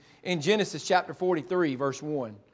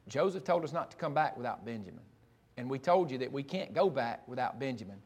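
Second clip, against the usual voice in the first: hushed reply; 7 semitones lower, 11 dB quieter than usual. Acted. Brothers answer father quietly.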